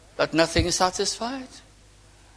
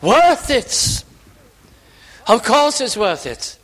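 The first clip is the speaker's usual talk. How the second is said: louder, higher, fervent preacher; emphatic preaching; 5 semitones higher, 4 dB louder than usual. Real, not acted. Preacher urging that one soul is worth it, emphatic preaching. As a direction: preach it out loud and high, punching key words.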